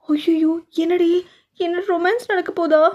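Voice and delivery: high, slow mock-gasp of a nosy auntie; gossipy shocked woman. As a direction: play the scandalised busybody peeking in. Gossiping women spying on romance. (வம்பு பேசும் அதிர்ச்சியான பெண் குரல்)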